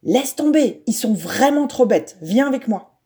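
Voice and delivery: a friend pulling him out of the teasing; exclaiming. A narrator's reading. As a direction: call him away from the bullies. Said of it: "Je m'écrie".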